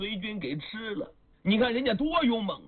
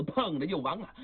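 ordinary delivery, nothing marked

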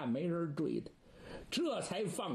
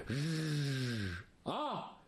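a sharp cry of horror; anguished cry; 2 semitones lower than usual. Acted. Disciple sees his master thrown into the pit, anguished cry. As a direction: cry out as if struck.

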